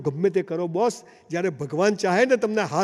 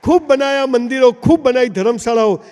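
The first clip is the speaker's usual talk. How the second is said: louder, rousing proclamation; proclaiming; 3 semitones higher, 7 dB louder than usual. Acted. Preacher rousing the crowd, loud proclamation. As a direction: call it out to the whole hall.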